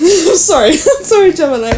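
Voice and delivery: giggling through I'm sorry; laughing apology. Real, not acted. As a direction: apologise while cracking up. Laughing apology after yawn.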